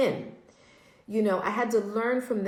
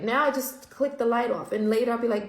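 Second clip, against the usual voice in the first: ordinary talk, nothing marked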